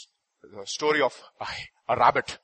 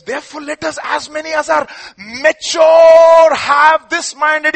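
high, loud, slow preaching; fervent preaching; 12 semitones higher, 16 dB louder, slower than usual. Acted. Preacher booming scripture to the congregation, slow and loud. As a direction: preach it out with full force.

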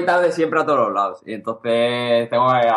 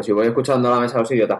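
ordinary talk, nothing marked

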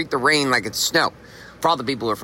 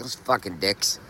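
muttered curse; 5 semitones lower, 6 dB quieter, slower than usual. Retold or imitated. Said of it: "that was the first thought I had".

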